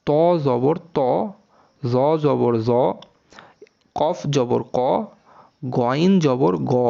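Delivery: ত, জ, ক, গ each ending in "aw"; measured drill with ত, জ, ক, গ vowels rounded; heavy rounded vowels. Shown as a demonstration. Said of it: "এটা হচ্ছে সঠিক উচ্চারণ".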